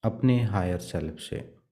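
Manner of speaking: soft, even meditation voice with long pauses; guided meditation. Acted. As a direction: guide them gently into prayer, leaving long silences. Leads meditation and prayer. (शांत, धीमे स्वर में ध्यान का निर्देशन)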